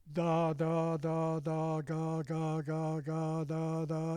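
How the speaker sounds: "da" and "ga" made to sound alike; steady tonguing syllables; even da ga syllables. Shown as a demonstration. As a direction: keep every "ga" matching "da". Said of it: "sound as much alike as you can".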